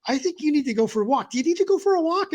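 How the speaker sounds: high-pitched imitated girlfriend. Girlfriend telling him to go for a walk, high imitated voice. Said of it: "sometimes my girlfriend says".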